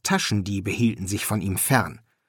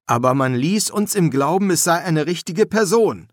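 higher, protesting lament; mildly wailing, aggrieved tone; plaintive; 6 semitones higher, 4 dB louder than usual. A narrator's reading. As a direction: complain as if you were misled. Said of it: "klagte Tepik".

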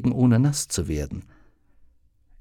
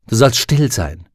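firm rebuke; 6 dB louder than usual. Acted. Susanne snaps at raven.

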